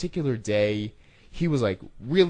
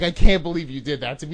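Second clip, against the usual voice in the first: loud, indignant outburst; retold cursing out; 5 semitones higher, 9 dB louder, faster than usual. Pranked rep scolds the volunteer, loud and indignant. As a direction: protest loudly at being pranked. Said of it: "I cursed him out".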